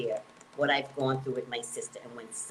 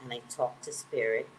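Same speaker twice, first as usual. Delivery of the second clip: ordinary talk, nothing marked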